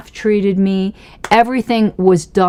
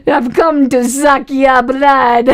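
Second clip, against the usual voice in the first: high, slow, hammed-up Dracula accent; fake Dracula accent; 6 semitones higher, slower than usual. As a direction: do a bad Count Dracula accent, drawn out. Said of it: "like Count Dracula".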